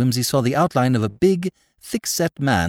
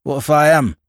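higher, louder surly comeback; curt retort; 4 semitones higher, 5 dB louder than usual. A narrator's reading. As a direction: throw it back at him. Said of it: "retorted the farmer".

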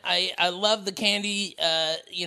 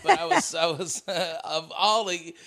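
ordinary talk, nothing marked

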